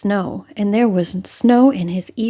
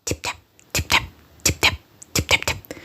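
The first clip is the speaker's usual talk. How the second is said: whispered tapping sound; 5 dB quieter, faster than usual. Acted. Mice tapping under teacups.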